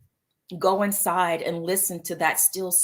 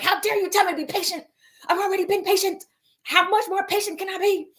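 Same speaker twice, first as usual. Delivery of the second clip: fast, much higher mock toddler whine; two-year-old tantrum; 11 semitones higher, faster than usual. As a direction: throw a hammed-up toddler fit. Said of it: "like, a two-year-old, just have a whole tantrum".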